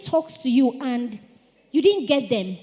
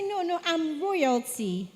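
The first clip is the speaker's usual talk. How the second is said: slow, soft, composed correction; unhurried and gently dignified; calm dignified; 2 semitones higher, 6 dB quieter, slower than usual. Shown as a demonstration. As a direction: answer with calm royal poise.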